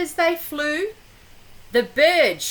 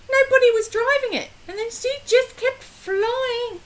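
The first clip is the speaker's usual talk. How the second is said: much higher, very fast; hurried telling; 8 semitones higher, faster than usual. Pretend. Reader telling the runaway broom, high and very fast. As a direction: rattle it off breathlessly.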